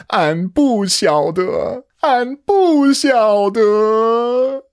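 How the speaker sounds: very high, drawn-out mock wail; slow, high-pitched comic cry building in volume; hammed-up wail. Hammed-up I don't know, teasing listeners.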